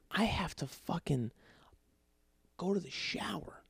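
sheepish whispered confession. Real, not acted. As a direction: whisper it like a guilty secret at night. Confessing the 3 a.m. shower trip.

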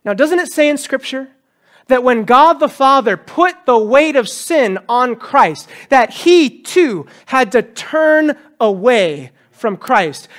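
normal talking voice

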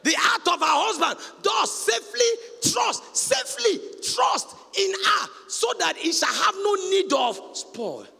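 slow, high, loud chanted reading; scripture recital. Acted. Preacher quoting Proverbs to the church, chanted and slow. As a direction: intone the verse like a proclamation.

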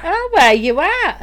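put-on Australian accent. Boyfriend repeating the game answer, mock Australian accent. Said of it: "said it in this weird accent".

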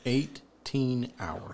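"Eighteen hours" drawn out very slowly in disbelief. Real, not acted. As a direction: repeat it slowly, stunned. Slow incredulous echo.

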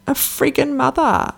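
put-on high, slow little-girl voice; child repeating. Young daughter repeating a rude word to mum, innocent child voice. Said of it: "And she said".

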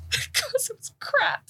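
high, wailing mock upset. Retelling being upset after a match, mock wail. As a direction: whine it like a sulking perfectionist. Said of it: "I'm like".

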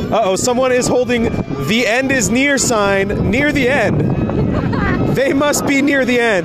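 excited play-by-play announcer; race commentator. Pretend. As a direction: call the race like a sportscaster.

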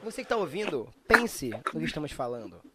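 mock campaign announcer voice; dramatic public service voice.

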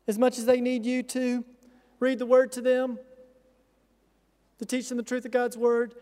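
usual speaking voice

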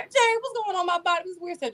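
high, fast flustered panic to her friend; mock panic. To her friend Jerry on the phone, high mock panic. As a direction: squeal it out like a frightened girl. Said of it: "I'm like calling Jerry".

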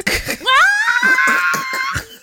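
very high shrieking laugh. Real, not acted. Friends watching reality TV, high screaming laugh.

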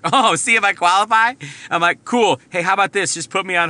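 a hammed-up indignant comeback on the phone; indignant sarcasm. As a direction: bristle with sarcastic disbelief.

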